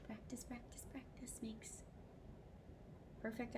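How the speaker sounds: whisper. Acted. Teacher stage-whispers a saying.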